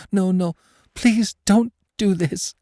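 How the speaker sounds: high, pleading whimper; a terrified woman begging not to be hurt. A narrator's reading. Captive woman begging her torturer, high whimper. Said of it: "she whimpered".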